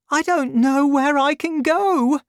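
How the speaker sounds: put-on high, light grateful young woman. A narrator's reading. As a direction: lift the voice for the young heroine.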